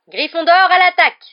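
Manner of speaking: high, loud excited shout; commentator's announcement. A narrator's reading. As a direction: shout the attack to the stands. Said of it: "annonça Lee Jordan". (annonce criée de commentateur)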